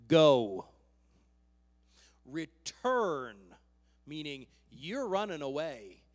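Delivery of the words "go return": sudden loud, low-pitched order; booming divine command. Acted. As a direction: fire off each word separately, with force. God's abrupt command to Elijah.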